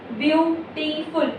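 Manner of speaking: Beautiful pulled apart into beau, ti, ful; slow syllable breaks. Shown as a demonstration. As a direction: pause slightly between the three syllables of beautiful. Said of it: "बीच में एक हल्का सा pause आता है".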